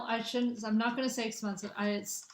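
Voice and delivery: ordinary delivery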